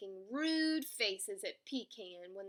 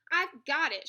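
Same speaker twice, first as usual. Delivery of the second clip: loud, higher boast; shouting; 5 semitones higher, 9 dB louder than usual. A narrator's reading. Hedgehog grabbing at the escaping nut, shouting. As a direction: shout it mid-scramble. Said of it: "shouted Hedgehog".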